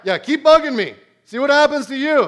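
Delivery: loud, high-pitched mock threat; threatening. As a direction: dare them to try it, loudly. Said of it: "That's a threat".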